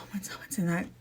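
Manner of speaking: drops into a hushed whisper. Real, not acted. Vlogger whispering a point to viewers, hushed. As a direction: lean in and whisper it low.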